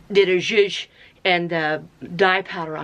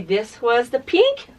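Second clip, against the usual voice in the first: usual speaking voice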